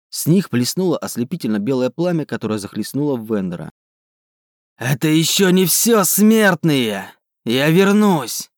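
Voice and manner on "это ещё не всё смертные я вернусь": thunderous roaring voice. Acted. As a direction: roar it like an angry god. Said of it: "проревел громовой голос".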